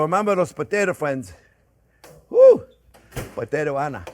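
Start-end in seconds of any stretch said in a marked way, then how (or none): 2.3-2.6 loud high whoop; big joyful cheer; booming sing song welcome. Real, not acted. Cheerful whoop after potatoes.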